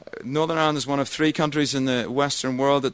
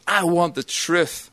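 slow, higher demanding film line; Tom Cruise; 5 semitones higher, slower than usual. Retold or imitated. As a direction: mimic the hero demanding the truth. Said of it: "it's Tom Cruise, says".

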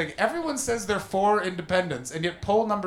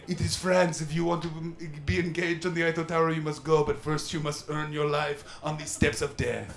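lightly done mock French accent; a hint of a pompous French guide. Frenchman explaining the Eiffel Tower steps, light mock French accent. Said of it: "My French accent is very, uh, racially insensitive".

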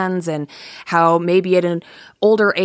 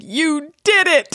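put-on slow, high old man proclaiming; loud grandpa voice; 12 semitones higher, slower than usual. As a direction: announce it proudly like grandpa. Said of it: "hearing aid grandpa voice".

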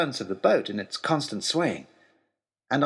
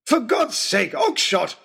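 bellowing; 8 semitones higher, slower than usual. Acted. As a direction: bellow a desperate warning. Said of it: "bellowed Lord Daunt".

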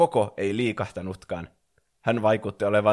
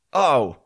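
high, loud "ow"; yelp of pain; 5 semitones higher, 6 dB louder than usual. A narrator's reading. Stranger landing on his back on the lawn, short pained yelp. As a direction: yelp as you hit the ground. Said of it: "Selälleen laskeutunut muukalainen älähti".

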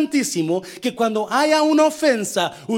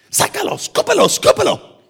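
mock urging shout; 9 semitones higher, 4 dB louder than usual. Mock patting back, spit it out.

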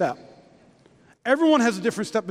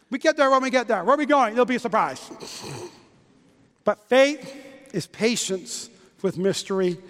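ordinary talk

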